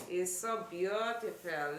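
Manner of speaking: normal talking voice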